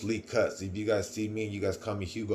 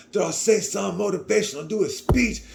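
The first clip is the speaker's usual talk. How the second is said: much higher, urging teammate voice; pushy hype; 9 semitones higher than usual. Retold or imitated. Teammates pressing Hugo to speak, excited and pushy. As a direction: call out for a speech like an excited friend.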